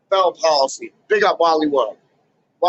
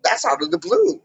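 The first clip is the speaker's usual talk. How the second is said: much higher, fake astonished gasp; mock surprise; 8 semitones higher than usual. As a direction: play dumb surprise. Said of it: "That was just like".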